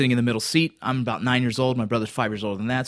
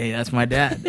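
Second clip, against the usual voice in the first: normal talking voice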